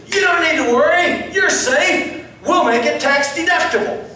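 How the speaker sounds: high, loud, emphatic exclamation; excited reassurance. Retold or imitated. Man tells the giver not to worry, loud and excited. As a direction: call it out with big enthusiasm. Man announces good news in story.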